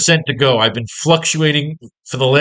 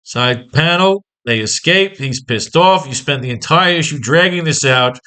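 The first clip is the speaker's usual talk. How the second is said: usual speaking voice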